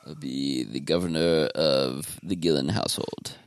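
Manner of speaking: ordinary talk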